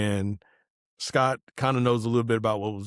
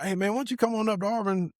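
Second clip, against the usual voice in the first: friendly invitation; 10 semitones higher than usual. Retold or imitated. Friend Vince's invitation voiced high.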